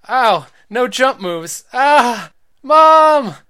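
very loud, high pained yell; crying out for mom. Acted. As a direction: scream for help. Boy hurt, calls mom.

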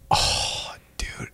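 deflated, breath-only sigh of dismay; crushed whisper. Retold or imitated. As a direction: let the air go out, retelling the blow. Retelling crushed reaction to client loss.

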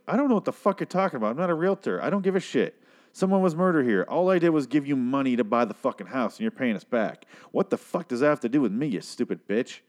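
high, fast rude banker; mock rude banker. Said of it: "him talking to Matt that way".

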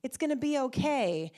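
mock-cheerful 'everything happens for a reason'; chirpy platitude. Adults brushing off pain with cheerful clichés, mock upbeat. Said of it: "even as adults, we go".

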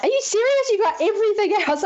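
can't believe it went so well; amazed disbelief. Retold or imitated. Director amazed at result.

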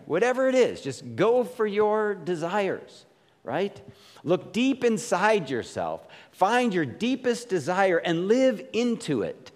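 lightly hammed-up self-help guru; mock advice. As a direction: parody the follow-your-heart slogan. Mocking worldly self-help advice.

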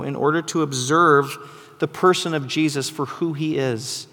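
normal talking voice

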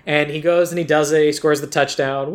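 normal talking voice

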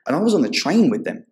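normal talking voice

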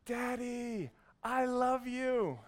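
put-on high, drawn-out kid's holler; little boy shouting to his dad; imitated child yelling. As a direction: call it slowly over a crowd like a small boy. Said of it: "then he yelled".

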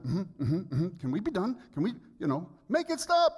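mock dismissive hums, rising into a loud high plea; husband signalling he wants the talk over, building to a loud plea; impatient brush-off. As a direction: hum along to hurry her, then beg. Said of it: "It's like".

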